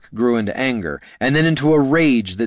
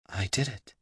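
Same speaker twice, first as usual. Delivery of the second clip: soft amazed whisper; 11 dB quieter than usual. Acted. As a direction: hush it in wonder. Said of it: "she whispered".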